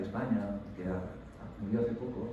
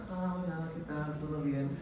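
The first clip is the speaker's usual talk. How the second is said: mock cheery greeting; 4 semitones higher, 5 dB quieter, slower than usual. Surface smile greeting, example.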